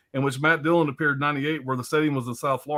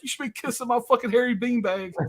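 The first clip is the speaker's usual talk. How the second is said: imitating exaggerated English accent; 9 semitones higher than usual. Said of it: "That's the way he talks".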